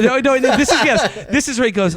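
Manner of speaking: laughing through the words; giggling as he corrects himself. Real, not acted. Laughing while correcting his co-host.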